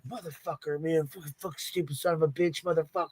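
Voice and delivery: soft imitated stream of swearing; background cussing. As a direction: cuss under your breath as if overheard from another room. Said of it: "you could hear him back there".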